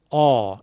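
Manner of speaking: آ full and thick in the throat; a heavy, full-volume آ; full heavy vowel. Shown as a demonstration. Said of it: "این آ رو بدیم به حرف ت". (آ پرحجم از حلق)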